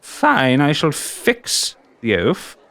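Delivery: high, giddy creature delight; creepy giggly voice. Acted. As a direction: coo over him like a mad collector. GM in shopkeeper voice.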